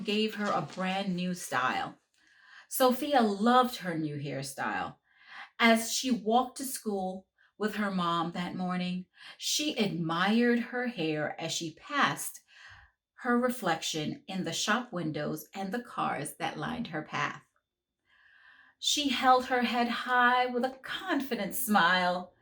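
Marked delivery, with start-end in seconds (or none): none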